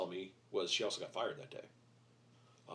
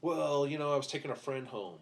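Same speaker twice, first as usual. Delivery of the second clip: mock daughter excuse; 5 semitones higher, 5 dB louder than usual.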